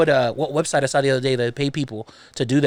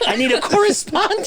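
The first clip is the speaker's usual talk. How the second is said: high-pitched plea through laughter; laughing mock plea. Host begging for backup on air, laughing mock plea. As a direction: call out helplessly, cracking up. Riffing as a helpless newscast host.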